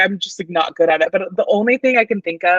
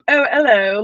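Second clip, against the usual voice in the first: put-on British accent; 5 semitones higher, 4 dB louder, slower than usual. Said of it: "I've attempted accents before".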